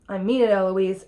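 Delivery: plain reading voice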